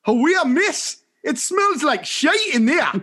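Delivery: put-on high-pitched Sunderland child; broad Mackem accent. Four-year-old announcing to the whole class, broad Mackem accent. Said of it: "with a very broad Macam accent".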